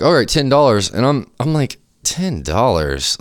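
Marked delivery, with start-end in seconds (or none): 0.0-0.9 higher-pitched, brisk retold clerk; offhand, higher-voiced quote of an offer; casual clerk offer. Retells the store clerk's offer. 2.0-3.2 slow, drawn-out disbelief; stretched-out, retold incredulity; incredulous. Retells disbelief at the low offer.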